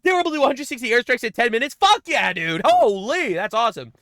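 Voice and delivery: loud, high sarcastic celebration; over-the-top fake excitement; mock cheering. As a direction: cheer it like great news, mockingly.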